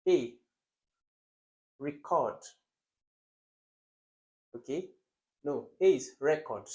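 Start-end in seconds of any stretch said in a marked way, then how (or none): 1.8-2.4 "record" stressed on the second syllable, re-CORD. Shown as a demonstration. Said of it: "correct stress pattern for two syllables". 6.2-6.7 "record" stressed on the first syllable, REC-ord. Shown as a demonstration. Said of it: "Record has the stress where it should be".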